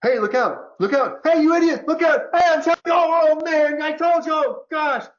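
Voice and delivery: high, loud, frantic warning shouts; panicked yelling. Retold or imitated. Speaker acts the Western mind yelling at an oncoming boat, frantic. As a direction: yell at someone who won't look out. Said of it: "The Western mentality is like".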